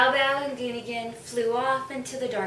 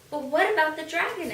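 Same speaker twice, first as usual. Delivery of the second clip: higher, quick anxious question; worried question; 6 semitones higher, 4 dB louder, faster than usual. Acted. Goonigan asking Pa, quick and worried. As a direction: blurt out the worry.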